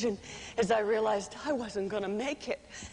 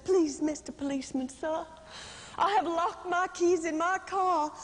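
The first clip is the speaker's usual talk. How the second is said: sweet Southern belle; 3 semitones higher than usual. Retold or imitated. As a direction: play the helpless Dixie belle, sweetly. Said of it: "in the most Dixie sweet Southern voice".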